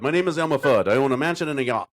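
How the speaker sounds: lightly voiced cartoon hunter; Elmer Fudd. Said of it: "That's Elma Fudd".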